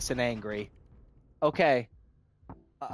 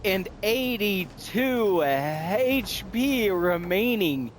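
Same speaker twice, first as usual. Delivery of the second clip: mock announcer; 4 semitones higher, slower than usual. Announcing her own victory.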